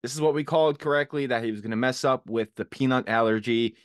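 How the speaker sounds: usual speaking voice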